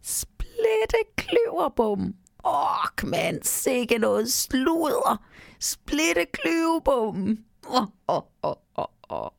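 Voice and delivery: high, slow, drawn-out tiny elf; mock them in a squeaky creature voice, then chuckle; teasing little goblin with a laugh. A narrator's reading. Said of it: "siger den lille stemme".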